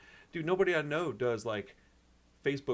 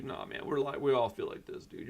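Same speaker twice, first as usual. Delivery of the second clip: normal talking voice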